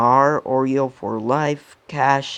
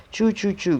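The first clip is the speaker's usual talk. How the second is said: train choo-choo; 7 semitones higher than usual. Acted. Hypnotized boy thinks he's a train.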